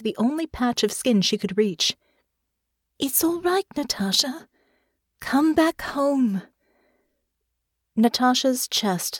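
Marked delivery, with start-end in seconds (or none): 3.0-6.4 high, tender reassurance; soothing. A narrator's reading. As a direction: coax gently, drawing out the last words. Soothing partner out of nightmare.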